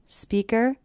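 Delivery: "Speaker" with the pitch climbing; continuing upward tune on "Speaker"; rise at the end. Shown as a demonstration. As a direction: lift the last syllable of "Speaker", unfinished. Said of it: "voice goes up at the end".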